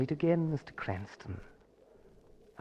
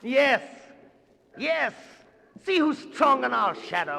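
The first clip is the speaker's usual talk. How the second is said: much higher exultant crowing; gloating triumph; 10 semitones higher, 3 dB louder than usual. Acted. Villain exulting as the giant obeys, gloating triumph. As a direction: crow over your victory.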